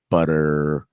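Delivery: low American BUTTER with a drawn-out r; American accent. Retold or imitated. Host repeating the teacher's contrast, American accent. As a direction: stretch the final r of butter, American style. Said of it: "difference between American and English accent".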